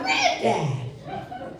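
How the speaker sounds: a high, startled shout of his name through laughter; scandalized yelp, half laughing; shocked laughing cry. Real, not acted. Shocked at grandfather's request.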